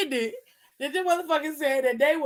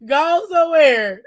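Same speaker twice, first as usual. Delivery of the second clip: playful shout-off; 3 semitones higher, 7 dB louder than usual. Real, not acted. Mock dismissal, louder.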